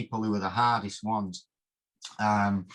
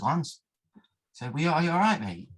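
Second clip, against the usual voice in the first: worried plea; 5 semitones higher than usual. Retold or imitated. Asking sparring partner if upset.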